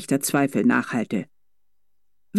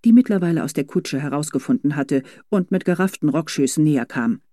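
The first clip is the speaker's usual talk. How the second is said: ordinary delivery, nothing marked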